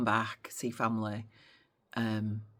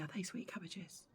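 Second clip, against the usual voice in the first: hushed, higher-pitched query; secretive aside; 5 semitones higher, 11 dB quieter than usual. Acted. Couple discussing foreigners in code, hushed secretive question. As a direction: ask it softly, on the sly.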